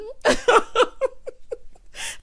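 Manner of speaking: high, wheezy laughing fit; helpless high-pitched laughter; long laugh. Real, not acted. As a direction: laugh till the voice gives out. Amused by the retold exchange.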